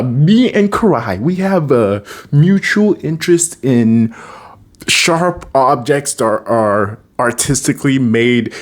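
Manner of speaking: slow, high, careful explaining; awkward excuse. Retold or imitated. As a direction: imitate someone stalling through an excuse. Acting Leo's awkward excuse.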